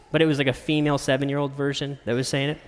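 usual speaking voice

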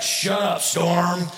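mock rebuke. Jesus rebuking the storm, mock stern command. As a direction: playfully order it to be silent. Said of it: "he rebukes the storm".

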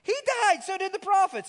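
incredulous mock outrage, pitched high; scornful challenge. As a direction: scoff at him as the indignant crowd. Crowd challenging Jesus.

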